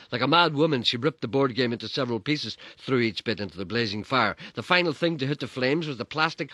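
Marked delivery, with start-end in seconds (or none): none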